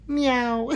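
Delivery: high little cat meow; mock meow. Reads chat meow as cat.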